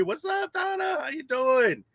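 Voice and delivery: high, softened falsetto-like holler; high-pitched hail. Real, not acted. Streamer greets a regular in chat, high playful hail.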